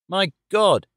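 acted horrified outburst; horrified cry. A narrator's reading. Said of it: "he cried".

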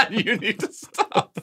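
high, slow laughing protest. Real, not acted. Laughing as he begs friends to stop teasing. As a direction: giggle while telling them to stop.

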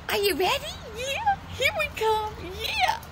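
high excited dog talk. Real, not acted. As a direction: pump up the dog. Hyping up the dog.

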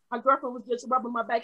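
usual speaking voice

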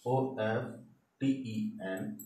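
"ओएफ़टीईएन" spelled letter by letter, slowly. Shown as a demonstration. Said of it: "spelling the word out". ("ओएफ़टीईएन" अक्षर-अक्षर धीरे बोलना)

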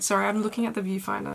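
usual speaking voice

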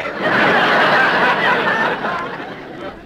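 high breathy burst of laughter; laughing. Real, not acted. Jack breaks up at the audience line, laughing.

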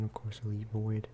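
ordinary delivery

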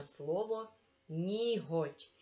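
ніготь said slowly so the г is heard clearly; slow, deliberate ніготь with a distinct г; clear emphasis. Shown as a demonstration. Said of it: "змінюємо на".

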